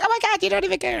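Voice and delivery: high-pitched imitation of an annoyed girlfriend; mock exasperated girlfriend. Acts out girlfriend annoyed at being ignored.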